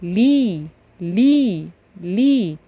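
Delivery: "لي" said slowly and clearly; sound demo. Shown as a demonstration. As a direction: sound out "لي" for the class. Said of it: "بالكسرة".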